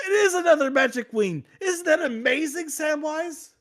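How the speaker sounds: very high and loud, laughing through it; giddy laughing squeal. Retold or imitated. Frodo crows to Samwise in a very high, laughing squeal. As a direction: squeal with manic delight. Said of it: "He's like".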